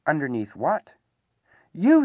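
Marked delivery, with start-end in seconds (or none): 1.7-2.0 very high, loud squeak; Piglet squeak. A narrator's reading. Squashed Piglet tells Pooh who is on top, squeaked. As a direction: squeak it out like a squashed little creature. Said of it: "squeaked Piglet".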